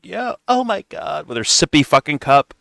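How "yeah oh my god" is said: high, slow, soft airhead voice; mocking hot mom. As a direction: parody a clueless mom, drawn out and higher. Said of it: "this fucking hot mom is like".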